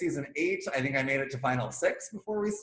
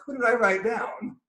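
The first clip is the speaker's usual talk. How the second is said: high, confused question; feigned surprise; 7 semitones higher, 3 dB louder than usual. Retold or imitated. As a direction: play dumb, surprised. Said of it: "And I'm like".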